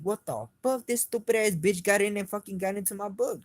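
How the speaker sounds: high, incredulous venting; pissed off. Retold or imitated. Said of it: "that shit pissed me off".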